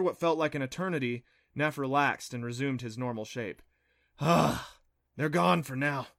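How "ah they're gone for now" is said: breath out in relief, then speak; a touch of weary relief; relieved sigh. A narrator's reading. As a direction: sigh out the all-clear. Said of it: "he sighed".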